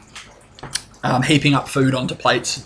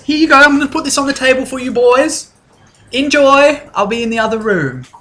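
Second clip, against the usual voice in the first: much higher, louder, sing-song hostess voice; wife Alyssa; 11 semitones higher, 3 dB louder than usual. Acted. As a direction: gush like a bubbly hostess, pitched way up. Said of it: "she says".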